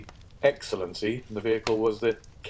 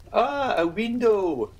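high, slow incredulous exclamation; much higher, drawn-out innkeeper exclamation; exclaiming landlord; 12 semitones higher, 4 dB louder, much slower than usual. Acted. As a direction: cry it out as if the idea is absurd. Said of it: "exclaimed Signor Pastrini".

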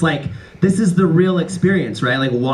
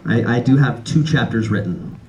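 normal talking voice

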